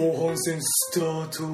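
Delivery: impersonation voice. Acted. Host asked to restart the second half as an impersonation, slow put-on voice. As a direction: draw out each word in a mock character voice. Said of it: "今モノマネで後半戦スタートって言って。".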